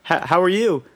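high, eager voice for both sides; mimicked chat. Teen recalls friend's dad quizzing him, high mock voices. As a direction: act out the awkward breakfast exchange, pitched high.

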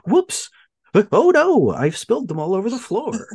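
high-pitched mock "oops"; feigned dismay. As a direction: play up a blatantly fake accident. Voices Wang pretending to spill the cure.

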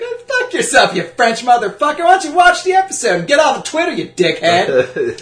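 high, loud hammed-up tirade; mock furious retort. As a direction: tear into him in a squeaky rage. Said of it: "I was about to be like".

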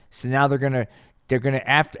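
normal talking voice

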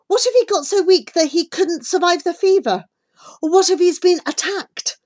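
high, loud, desperate pleading. A narrator's reading. Child fearing for a sick friend, pleading with the boy. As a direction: plead with rising alarm for a friend. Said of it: "I plead with him".

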